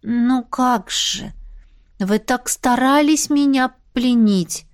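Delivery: words stretched out slowly; slow drawl. Acted. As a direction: drag each word out to stall. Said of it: "Я намеренно говорила медленно, растягивая слова".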